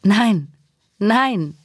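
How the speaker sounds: high, forceful cry of refusal with falling tune. A narrator's reading. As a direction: cry out in protest, pitch jumping up. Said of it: "schrie Nandi".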